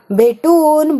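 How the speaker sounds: disbelieving repeat; incredulous echo. Acted. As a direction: throw the word back in mild disbelief. Caller repeats the suggestion incredulously.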